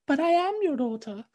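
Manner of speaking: put-on high, eerie, quiet child's voice; possessed daughter voice. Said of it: "It's like".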